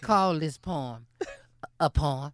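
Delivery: low smooth crooning voice; sultry narrator. Acted. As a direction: keep it low and silky. Smooth low poem intro.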